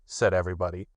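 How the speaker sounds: ordinary delivery, nothing marked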